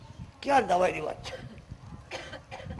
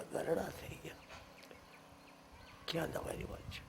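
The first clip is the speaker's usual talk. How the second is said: whisper; 6 semitones lower, 17 dB quieter than usual. Real, not acted. Preacher drops to a whisper.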